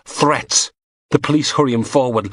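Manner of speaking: ordinary delivery, nothing marked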